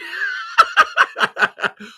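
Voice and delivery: very high, loud cackle; big cackling laugh. Real, not acted. Host cracking up at her own jab, cackling. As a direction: explode into high-pitched laughter.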